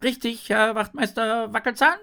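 high, loud subordinate shark; eager junior officer. A narrator's reading. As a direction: ask the superior eagerly, pitched high. Junior shark asks his superior. (eifriger, hoher Hilfspolizist)

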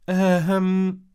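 high hesitant hum; stammering mom. A narrator's reading. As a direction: Mom caught in a knot. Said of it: "stammelte Mom". (stammelnde Mutter)

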